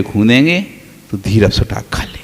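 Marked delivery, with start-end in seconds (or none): 1.1-2.2 hushed whisper. Real, not acted. Preacher whispers how the sulker sneaks food. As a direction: whisper it like a secret. Quietly describes child eating later.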